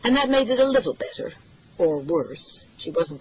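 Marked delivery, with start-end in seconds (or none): none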